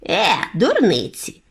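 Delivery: ordinary delivery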